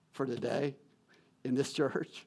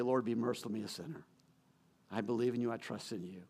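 soft, humble prayer, dropping lower and softer to almost nothing; a hushed sinner's prayer, sinking lower; 3 semitones lower, 9 dB quieter than usual. Acted. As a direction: pray it quietly as a humble sinner.

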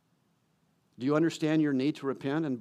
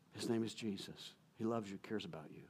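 tender appeal; 5 semitones lower, 15 dB quieter than usual. Real, not acted.

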